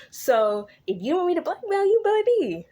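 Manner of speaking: high, sing-song mock threat; taunting blackmailer. Patient blackmailing his psychiatrist, taunting.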